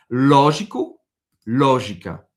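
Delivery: "Lógico" with open stressed LÓ; Portuguese word. Shown as a demonstration. Teacher models the accented Portuguese word, stressed LÓ. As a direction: hit the LÓ of "Lógico". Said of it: "accent circonflexe".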